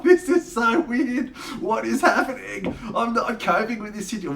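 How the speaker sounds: high, loud mock panic through laughter; imitated freaking out. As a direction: mimic a friend losing it, voice pitched right up. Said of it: "He's, like, freaking out".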